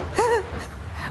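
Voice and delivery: high breathless evil cackle; witchy wicked laugh. Acted. Spooky host cackles.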